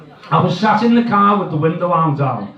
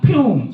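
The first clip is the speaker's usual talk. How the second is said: imitated price gun zap; 6 semitones higher than usual.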